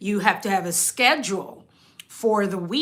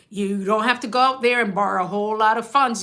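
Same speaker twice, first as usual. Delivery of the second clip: ordinary talk, nothing marked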